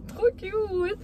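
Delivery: squealed high over something cute; gushing. Real, not acted. Vlogging about her café haul, squealing with delight. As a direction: gush like it's adorable.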